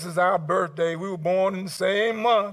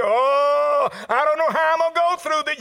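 loud, high mock lament; anguished believer; 7 semitones higher, 7 dB louder than usual. Preacher voicing someone going through a trial, loud acted lament. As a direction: cry out as someone overwhelmed.

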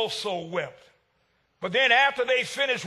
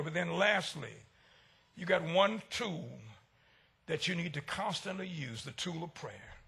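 lower, softer, fading to a hush; 6 semitones lower, 9 dB quieter than usual. Real, not acted. Preacher hushing the church to speak of prayer. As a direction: let it die away to almost nothing. Preacher fades to near whisper.

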